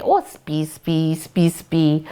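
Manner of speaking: cooing, repeated pet name; loving. Shown as a demonstration. Owner showing how she sweet-talks her dog, loving. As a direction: fuss fondly over a dog. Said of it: "the tone changes".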